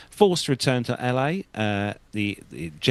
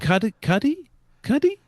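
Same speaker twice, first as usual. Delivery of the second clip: "Cuddy?" pitched higher on each try; rising questioning tries; 12 semitones higher than usual. Real, not acted. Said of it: "How would you pronounce that".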